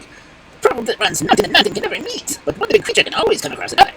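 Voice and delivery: high-pitched bird reciting; proverb-quoting wild duck. Acted. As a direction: recite it as a little squeaky duck.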